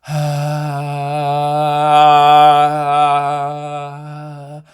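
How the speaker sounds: long, loud breathy sigh on a high note. Shown as a demonstration. Out of breath after demoing breathy voice, long sigh. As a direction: let all the air rush out. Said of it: "ran out of breath on the breathy".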